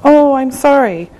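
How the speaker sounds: ordinary talk, nothing marked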